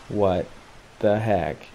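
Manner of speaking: halting, hushed disbelief; stunned disbelief. Retold or imitated. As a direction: let each bit drop, stunned. Stunned at heading for pier.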